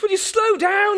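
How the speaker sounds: very high, loud desperate yell; retold yell. Policeman waving flares, yelling at speeding drivers. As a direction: bellow it at the top of your voice. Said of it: "he yelled at the top of his voice".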